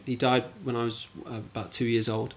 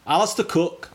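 higher-pitched put-on Scottish accent; 6 semitones higher, 4 dB louder than usual. Shown as a demonstration. Quiz guest naming a cricketer, in a Scottish accent.

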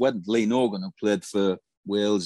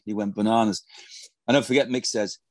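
ordinary delivery

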